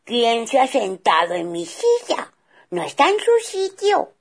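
loud, high indignant bear; father bear angry at the moved chair; big bear blustering, loud and high. Acted. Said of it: "exclamó muy enfadado".

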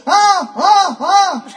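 high, loud string of ahs; repeated ah. A narrator's reading. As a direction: shout ah over and over in triumph. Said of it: "y así hasta ciento". (ahs repetidos, agudos y a gritos)

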